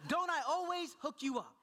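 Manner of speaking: high-pitched, exasperated appeal; worked-up parent's catchphrase; pleading dad. Retold or imitated. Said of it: "this is the one line I give him".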